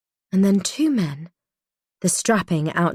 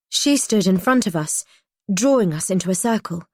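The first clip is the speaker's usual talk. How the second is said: ordinary delivery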